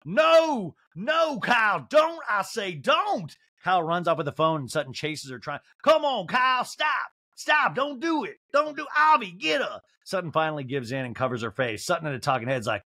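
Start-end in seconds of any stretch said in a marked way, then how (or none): 0.1-3.3 high-pitched shouted Southern protest; mimicked screaming. Host mimicking Sutton screaming at Kyle over her phone, mock scream. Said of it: "Sutton screams at her". 5.8-9.8 high-pitched pleading calls; mock frantic shouting. Housewife calling for help getting her phone back, mock frantic cries. Mimics Sutton chasing Kyle.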